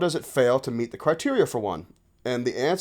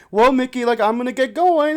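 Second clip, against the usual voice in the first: high, playful doll chat; imitated aunt chatting to a toy; Auntie Gloria; 9 semitones higher, 3 dB louder than usual. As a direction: take leave of a doll in a raised voice. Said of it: "doing the Mickey Mouse voice".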